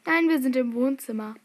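ordinary talk

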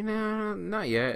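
high, drawn-back mock reply; acted awkward no; sheepish denial. Acts sheepish answer to friends.

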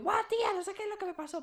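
high, excited burst of news; excited gush. Retold or imitated. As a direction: gush it out like you can't hold it in. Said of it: "voy súper emocionada y también hablo muy alto".

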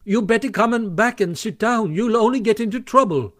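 Rat calling out high after someone leaving; calling after Toad. A narrator's reading. Rat calls after Toad storming off, pleading. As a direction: shout a warning down the path. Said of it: "called the Rat after him".

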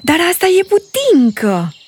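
put-on a little girl's excited discovery; delighted exclamation. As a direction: pounce on the word with glee. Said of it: "exclamă ea".

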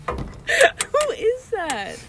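high, drawn-out laughing shriek; laughing squeal of disbelief. Real, not acted. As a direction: stretch it out mid-laugh, way up high. Disbelief at Ugly Betty.

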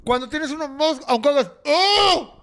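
high, slow, emphatic protest; excited. Real, not acted. Arguing the mask blocks it.

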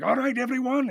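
high, loud rallying call; raid leader's shout. Acted. Raid leader shouting to the group as a raid begins, a rallying call.

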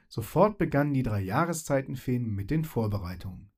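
ordinary delivery

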